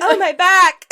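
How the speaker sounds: loud, high cry of fake agony; mock pained yell. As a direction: yell as if your back just went, hammed up. Retold man throws out his back.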